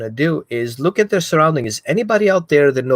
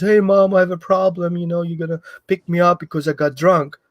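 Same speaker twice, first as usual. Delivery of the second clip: lightly voiced teen, a bit higher; child calling mom; 4 semitones higher than usual. Child calling mom for a pickup, lightly higher voice. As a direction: play the kid confessing on the phone, mild. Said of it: "when it calls and says".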